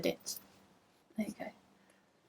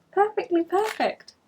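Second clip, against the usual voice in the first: Perfectly perfect squeaked much higher and louder; squeaky delight; 9 semitones higher, 4 dB louder than usual. Shown as a demonstration. Knitter squealing over gift yarn to viewers, high squeak. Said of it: "Probably can't hear me when I'm doing that".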